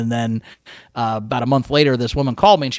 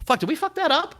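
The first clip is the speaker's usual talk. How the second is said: small, high, softer mock-worried question; sheepish realization; 12 semitones higher, 7 dB quieter than usual. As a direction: act out dawning regret in a little high voice. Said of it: "you gotta be like".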